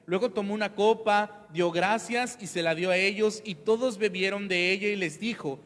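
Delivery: loud, measured Bible reading; solemn scripture reading. Acted. Pastor reads the Last Supper to the congregation, solemnly. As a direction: proclaim the passage to the church.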